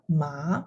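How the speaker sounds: "ma" starting low and rising; rising second tone. Shown as a demonstration. Teacher's example from the video, rising "ma". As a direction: lift "ma" like a question. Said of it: "here's an interesting example".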